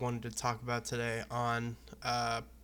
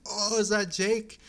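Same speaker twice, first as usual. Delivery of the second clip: high, loud, drawn-out excited greeting; imitated excited exclamation, slow and loud; 9 semitones higher, 7 dB louder than usual. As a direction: pitch it way up, delighted recognition. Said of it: "and he's like".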